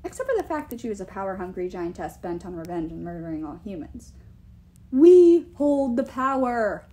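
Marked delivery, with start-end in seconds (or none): 4.9-6.8 slow, loud, imperious declaration; insistent giant king. A narrator's reading. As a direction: insist forcefully, drawing out each word. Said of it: "Thrym insisted".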